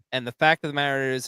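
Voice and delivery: normal talking voice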